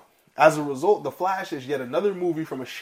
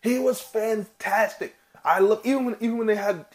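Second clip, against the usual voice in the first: excited; 8 semitones higher, 4 dB louder than usual. Real, not acted. Gushing about Peter Weller.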